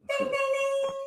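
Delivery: high sung ding ding ding; bell imitation. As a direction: ring it out like the round bell.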